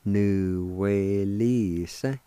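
nuwelisa stretched out smoothly; slow pronunciation. Shown as a demonstration. Teacher showing how nuwelisa joins up, slow and drawn out. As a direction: glide slowly through every syllable of nuwelisa. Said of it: "It's pronounced like this".

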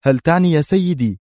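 high, keyed-up subordinate; بيرت, alert. A narrator's reading. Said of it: "غمغم بيرت في تحفز".

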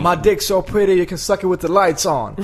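high, loud stand-up comic impression; Tracy Morgan. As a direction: belt out the brag in a high comic voice. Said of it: "pratar så här".

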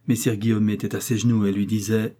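ordinary delivery

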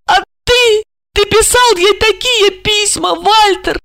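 very high, wounded wife's lament; plaintive. A narrator's reading. Wife reproaching husband over letters, plaintive. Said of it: "жалобно спросила".

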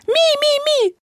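very high creature squeaks; piercing, repeated little squeaks; wild squealing. A narrator's reading. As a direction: squeal like a frantic little animal. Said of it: "дико вереща".

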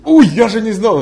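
loud, high startled "Ой"; startled apology. Retold or imitated. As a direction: blurt it out caught off guard. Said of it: "Я говорю". (громко, высоко, с испугом)

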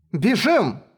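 urgent cry to run; sudden shout. Acted. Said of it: "Эшли внезапно закричала".

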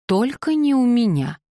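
high-pitched protest of innocence; emphatic objection. A narrator's reading. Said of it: "возразила Пенелопа".

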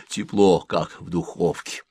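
usual speaking voice

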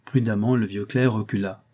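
usual speaking voice

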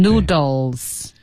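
"Noodles" drawn out with an audible L. Shown as a demonstration. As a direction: clear dark L before the s in "Noodles". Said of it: "有L音".

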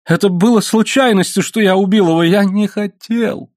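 high-pitched frantic pleading; desperate excuse. A narrator's reading. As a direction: plead that it was an accident. Accused man excuses the killing.

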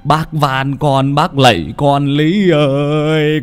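slow, wailing plea with each "Lý ơi" drawn out; terrified begging. A narrator's reading. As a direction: beg the ghost in terror, stretching her name. Terrified old man begs ghost. (ông Toán van xin hồn ma, giọng run sợ kéo dài)

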